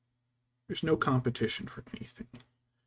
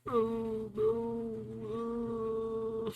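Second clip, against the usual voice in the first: long, high mock moan of dread; high drawn-out "oh no" groan; dismayed cat; 11 semitones higher, much slower than usual. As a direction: moan like a cat unhappily picked up. Voices a cat being picked up.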